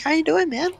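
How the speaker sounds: high, put-on friendly voice; mock friendly greeting. Storyteller as police greeting his friend in the park, high mock-friendly voice. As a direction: falsetto nice-cop hello.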